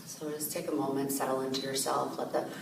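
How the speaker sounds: calm, softened voice with long pauses; guided meditation. Real, not acted. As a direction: gently guide listeners inward, leaving space to breathe.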